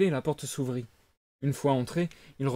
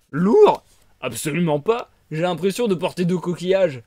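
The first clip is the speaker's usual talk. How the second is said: hearty loud scoff from a big fish; tuna voice; 3 semitones higher, 8 dB louder than usual. Acted. Tuna scoffing at Pinocchio's worry, loud and hearty. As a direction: laugh off the weight, booming.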